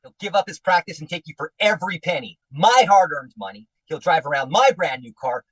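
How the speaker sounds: usual speaking voice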